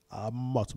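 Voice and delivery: Amote said softly with a TS ending; biblical pronunciation. Shown as a demonstration. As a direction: softly model Amote, releasing the final TS. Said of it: "Même la prononciation". (Amote tout bas, avec la finale TS)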